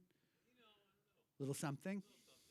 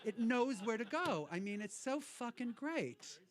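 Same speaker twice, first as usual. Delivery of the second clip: ordinary talk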